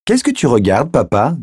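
put-on high child's voice rising into a question; curious little girl. A narrator's reading. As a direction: ask innocently like a small kid. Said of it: "demanda Emily".

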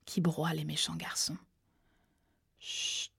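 chuchoté sans voix, de plus en plus bas; les derniers mots d'un homme à bout de forces; murmure d'un mourant. A narrator's reading. Frère mourant parle dans un souffle. (le frère mourant parle à Sal, dans un souffle)

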